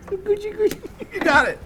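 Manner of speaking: very high squeaky falsetto; goofy falsetto. Pretend. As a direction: sing it out in a silly squeak. Goofy voice after finishing.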